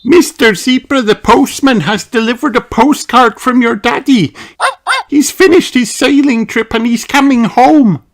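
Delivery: happy mum sharing good news; Mummy Dog. A narrator's reading. Mummy Dog telling Danny his daddy is coming home, high happy voice. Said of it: "says Mummy Dog".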